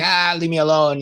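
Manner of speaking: imitating a cranky self pushing people away; mock cranky snap. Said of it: "and I'm going".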